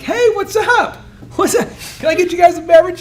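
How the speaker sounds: salesman cheer. Retold or imitated. Speaker greeting brides at a sales meeting, high and loud. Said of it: "I'm like".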